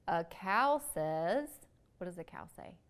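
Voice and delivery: slow, sing-song picture-book reading; reading to children. Acted. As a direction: read it out slowly for little kids.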